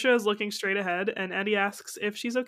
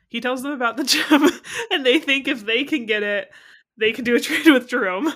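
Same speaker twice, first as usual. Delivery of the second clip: loud, higher, laughing through the words; 3 semitones higher, 10 dB louder than usual. Real, not acted. Host recapping the episode to co-hosts, laughing loudly.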